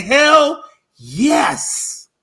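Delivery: high, thrilled "Hell yes"; excited hell yes. Acted. Cowboys fan gloating to Eagles fan, excited. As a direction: cheer it like a gleeful rival. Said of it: "all I can do is say".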